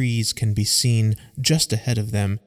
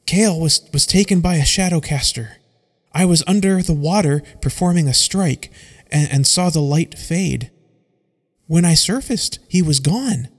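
higher, louder, rushed report between gasps; out of breath; 6 semitones higher, 4 dB louder than usual. Acted. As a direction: blurt it out while still winded. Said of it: "still trying to catch her breath".

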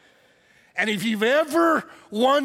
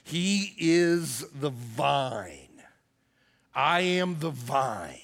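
very slow, lower, solemn words of Jesus; slow scripture quote; 7 semitones lower, 4 dB quieter, much slower than usual. Retold or imitated. Pastor quoting Jesus to the congregation, slow and solemn. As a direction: read it with reverence. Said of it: "And Jesus says".

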